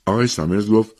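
plain reading voice

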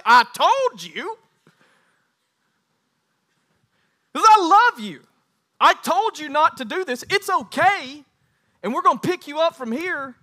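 loud, high preacher's admonishing; forceful tough love. Acted. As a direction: raise the voice in loving reproach. Acts out loving, forceful I-told-you-so.

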